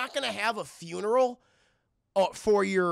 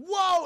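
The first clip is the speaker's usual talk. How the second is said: mock yell; 13 semitones higher, 6 dB louder than usual. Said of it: "It's like".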